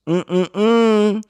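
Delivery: high, loud, emphatic mm-mm-mm; relishing hum. Pretend. Joking about the cartoon cat, relishing hum. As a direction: hum three times with hammed-up relish.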